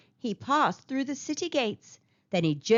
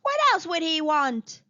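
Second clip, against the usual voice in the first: cried out higher; the crowd calls back in disbelief; mild mock crowd outcry; citizens cry out; 6 semitones higher, 3 dB louder than usual. A narrator's reading. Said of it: "the citizens cried".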